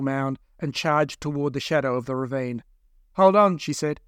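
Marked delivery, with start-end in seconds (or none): 3.1-3.6 loud, high warning; urgent call. Acted. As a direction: brace them with a loud warning. Eve warns while driving.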